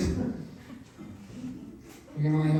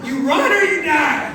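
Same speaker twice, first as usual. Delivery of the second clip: loud, high mock-epic shout, laughing; 9 semitones higher, 11 dB louder than usual. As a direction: bellow it like a fantasy motto. Parody Game of Thrones line.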